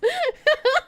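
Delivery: giggling at her own joke; high burst of laughter. Real, not acted.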